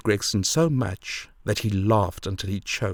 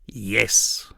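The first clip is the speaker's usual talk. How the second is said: slightly strained single word; husky, unnatural. A narrator's reading. Frightened mother getting out one word, huskily. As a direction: force out a mildly husky answer. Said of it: "it was in a husky, unnatural tone".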